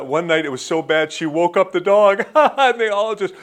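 loud, laughing retelling; breaking into laughter. Retold or imitated. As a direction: crack up as you land the joke. Retells joke, cracks up laughing.

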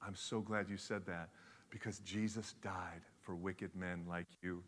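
soft, low, nearly whispered good news; gentle whispered reply to the wicked man; hushed reply. Retold or imitated. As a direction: drop to a whisper for the punchline. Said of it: "And she says this".

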